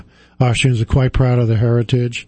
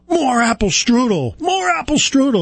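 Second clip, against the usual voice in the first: demanding yell; 12 semitones higher than usual. Retold or imitated. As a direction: bark the order like a stern German customer. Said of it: "I yelled out".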